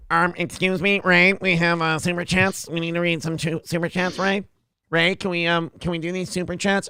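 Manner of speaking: put-on pestering voice at ordinary pitch; Producer Joe. Producer butting in about super chats, mock pestering voice. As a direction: interrupt in a mocking put-on voice. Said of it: "his voice is a little higher".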